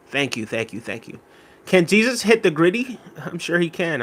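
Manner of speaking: ordinary delivery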